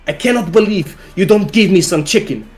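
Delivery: mildly angry imitation in a Russian accent. Russian date complaining about dinner, mildly angry Russian accent. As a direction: sulk like a child in a Russian accent. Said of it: "She's all angry".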